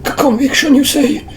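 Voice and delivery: high, stuttering villain voice; squeaky incredulous showman; high character voice. Acted. Voicing Atme character.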